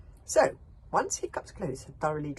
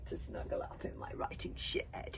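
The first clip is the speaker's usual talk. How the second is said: ordinary delivery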